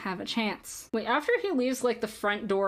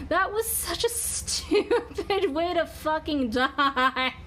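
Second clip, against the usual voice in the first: high, slow, drawn-out disbelief; incredulous high-pitched complaint; exasperated outburst; 6 semitones higher, much slower than usual. Real, not acted. Exasperated at losing the game.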